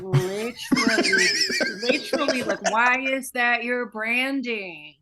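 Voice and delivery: loud, drawn-out disbelief; incredulous outburst. Real, not acted. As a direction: stretch each word out in outraged disbelief. Outraged rant about church logo.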